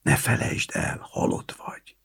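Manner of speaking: hushed, secretive whisper; whispered reminder. A narrator's reading. Kriszta reminding Éva to play dead, whispering. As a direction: whisper a warning into an ear. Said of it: "sugdosta Kriszta Éva fülébe".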